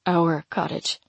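low, throaty correction. A narrator's reading. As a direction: let it rumble deep in the throat. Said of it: "he throatily amends".

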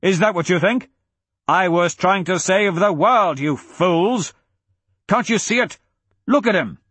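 high, exasperated rant; Braga, bellowing. A narrator's reading. Braga rails at the fools backing the prince, bellowing. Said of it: "Braga bellowed".

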